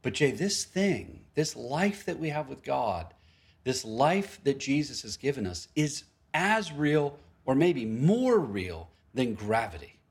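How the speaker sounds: slow, deliberate conviction; slow earnest emphasis on real life with God. Retold or imitated. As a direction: lay each word down slowly. Retelling Alex's conviction.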